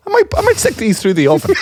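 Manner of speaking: high-pitched mock bright idea, on the edge of laughing; incredulous mimicry. Friend imagining the worker's thought about crisps, mock-clueless.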